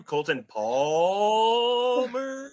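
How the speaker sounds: teasing a guest's name; mock pronunciation. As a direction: say the name slowly like you're unsure of it. Host mimics pronouncing name.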